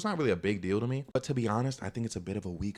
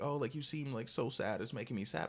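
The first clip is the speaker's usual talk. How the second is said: soft retold fussing over someone; mock pitying; 4 semitones higher, 6 dB quieter than usual. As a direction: imitate a softly pitying friend. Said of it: "trying to convince me to, like, be sad".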